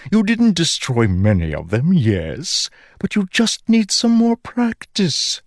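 high, bright magic sword voice; chirpy enchanted sword, eager to praise; ringing metallic sword voice. Acted. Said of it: "the sword said".

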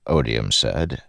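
ordinary delivery, nothing marked

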